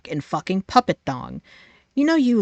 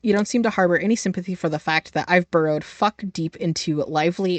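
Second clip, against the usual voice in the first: ordinary talk, nothing marked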